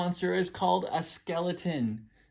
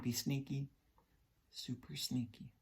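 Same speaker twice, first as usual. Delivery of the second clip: sneaky hushed voice; 10 dB quieter than usual. Acted. Sneaky creeping, soft.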